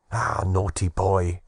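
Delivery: slow, playful mock scolding; arch teasing. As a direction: tease him with a grin in the voice. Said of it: "in an arch and laughing tone".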